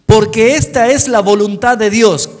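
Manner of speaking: leído fuerte, con fuerza de predicador; lectura bíblica en voz alta. Acted. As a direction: proclama el versículo a la congregación. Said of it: "¿Qué dice allí?". (lectura enfática del versículo)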